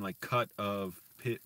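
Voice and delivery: ordinary talk, nothing marked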